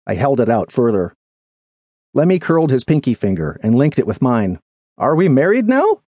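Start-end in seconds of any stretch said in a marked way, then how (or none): 5.0-6.0 high voice rising into a question; puzzled friend. Acted. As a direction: ask in naive bewilderment, pitched up. Lemmy's puzzled question after pinky link.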